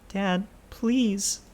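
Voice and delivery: high, small voice begging Dad; young pleading voice. Acted. Child begging his father, high and pleading. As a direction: plead with him like a scared child.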